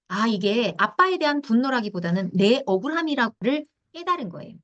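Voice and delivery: ordinary talk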